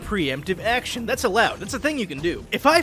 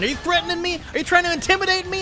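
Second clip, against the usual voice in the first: high, fast mock offence at a harmless enemy; mock outrage; 8 semitones higher, faster than usual. Player taunting an enemy champion, mock outraged. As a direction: play wounded innocence while attacking.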